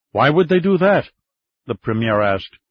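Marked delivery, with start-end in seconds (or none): 0.1-1.1 high, louder puzzled question; nonplussed. Acted. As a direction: caught off guard, asking why. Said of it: "somewhat nonplussed by this".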